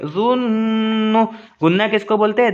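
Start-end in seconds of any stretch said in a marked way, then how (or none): 0.1-1.2 जुन्नु with a long hummed न; slow, level hum on the न of जुन्नु; ghunna nasal hold. Shown as a demonstration. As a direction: sustain the न of जुन्नु through the nose. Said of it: "गुन्ना करके पढ़ेंगे".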